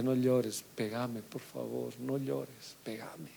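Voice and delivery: soft, fading plea to a weeping father; pleading with father. Retold or imitated. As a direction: beg him gently, dropping to a whisper. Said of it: "Y le dije".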